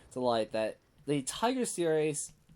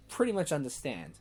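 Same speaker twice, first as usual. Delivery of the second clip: normal talking voice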